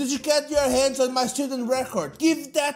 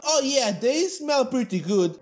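usual speaking voice

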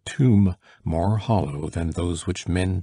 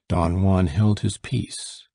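plain reading voice

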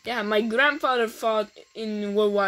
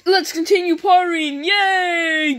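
excited; 6 semitones higher, 4 dB louder than usual. Acted. Party resumes.

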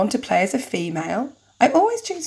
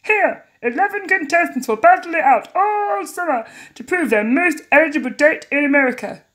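higher, swooping announcer voice; TV show host; 9 semitones higher, 4 dB louder than usual. Acted. Host welcomes the crowd, hammed-up announcer voice. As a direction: present it grandly, hammed up. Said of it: "What was his voice?".

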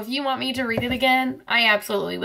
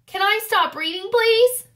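pleading; 9 semitones higher than usual. Pretend. Reader begs to stop.

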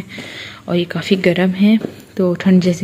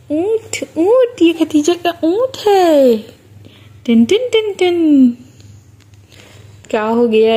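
put-on high, sing-song cooing at a baby; baby talk; 7 semitones higher, slower than usual. Mother coaxes her serious baby daughter with high cooing. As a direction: coo to the little one to get a smile.

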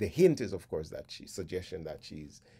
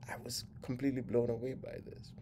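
whisper; 5 semitones higher, faster than usual. Real, not acted. Awed aside about the lyrics.

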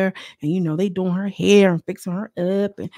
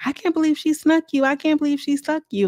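mock gossip; 8 semitones higher than usual. Imitating the castmates gossiping in the bathroom, high. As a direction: play the girls gasping in shock. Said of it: "They're all in there".

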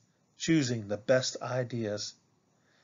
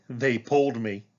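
ordinary delivery, nothing marked

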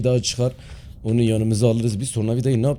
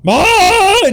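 startled yell; 8 dB louder than usual. Real, not acted.

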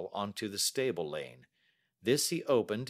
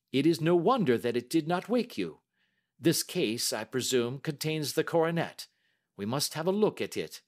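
raised, assured detective's voice; Holmes; 7 semitones higher, 4 dB louder than usual. Acted. Detective turning to the coronet's case, assured. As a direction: reason aloud, firm and businesslike.

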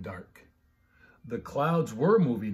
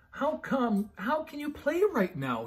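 high, small scared question; a pup trembling in the storm; frightened puppy voice; 11 semitones higher than usual. A narrator's reading. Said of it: "he asked".

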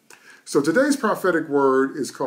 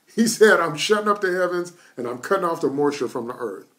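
preaching at full force; 4 semitones higher than usual. Acted. Preacher rousing the congregation about Elijah's word, full-force preaching. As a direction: hammer each phrase home to the congregation.

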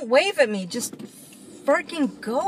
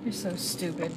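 much softer, under the breath; muttered insult; 7 semitones lower, 11 dB quieter than usual. Real, not acted. Driver muttering an insult at a pedestrian, muttered. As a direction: mutter it so they can't hear.

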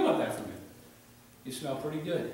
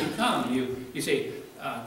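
ordinary talk

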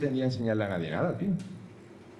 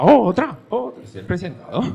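crowd cheering; 7 semitones higher, 7 dB louder than usual. Retold or imitated. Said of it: "el público gritaba".

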